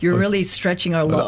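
lightly voiced American dad; quoted dad phrase. Co-host quoting colleague's dad's catchphrase, lightly imitated. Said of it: "the phrase your dad came out with".

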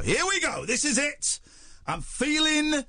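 high, rising excitement on the connection; excited anticipation. Real, not acted. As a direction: build up the big moment, high-pitched. Call connecting, host builds up hope.

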